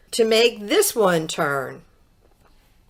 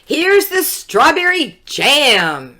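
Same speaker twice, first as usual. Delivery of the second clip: higher, a bit louder eager call; shouted; 6 semitones higher, 3 dB louder than usual. A narrator's reading. Jack bringing the jam to his mother, mild shout. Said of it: "shouted Jack".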